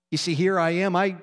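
ordinary talk, nothing marked

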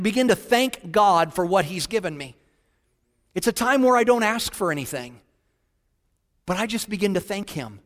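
normal talking voice

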